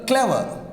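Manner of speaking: "clever" with the first syllable punched high, falling away; stress on first syllable. Shown as a demonstration. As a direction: hit the start of "clever", let the end drop. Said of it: "we give the stress here".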